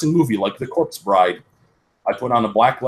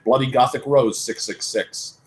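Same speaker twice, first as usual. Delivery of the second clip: ordinary delivery, nothing marked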